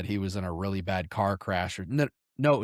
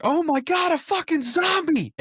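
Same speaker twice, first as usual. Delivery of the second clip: loud, very high-pitched terrified shriek; panicked scream; 6 dB louder than usual. Retold or imitated. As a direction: reenact scared teenagers screaming in panic. Teenagers panicking at a supposed zombie.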